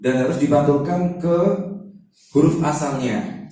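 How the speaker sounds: ordinary talk, nothing marked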